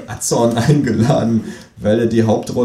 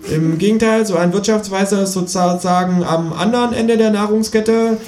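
high, cheeky kangaroo voice; 8 semitones higher than usual. Acted. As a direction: lecture the audience in a high voice. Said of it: "meldet sich das Känguru zu Wort".

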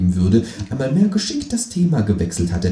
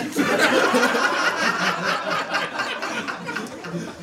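high laugh; 4 dB quieter than usual. Real, not acted. Laugh after punchline.

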